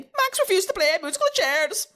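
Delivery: high, loud sing-song headline; announcer headline. Acted. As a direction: proclaim it high like a show segment title. Reads segment headline as announcer.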